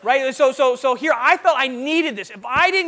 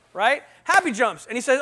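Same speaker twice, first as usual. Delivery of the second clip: normal talking voice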